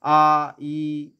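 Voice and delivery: "আ" and "ই" each held long and level; long vowels. Shown as a demonstration. Teacher listing long vowels, "আ" and "ই" drawn out. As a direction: sustain "আ", then "ই". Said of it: "দীর্ঘস্বরগুলো কি কি".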